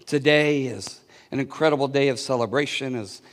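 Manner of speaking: low, soft prayer voice. Real, not acted. Pastor opens a prayer for the church, soft and low. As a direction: speak to the Father quietly.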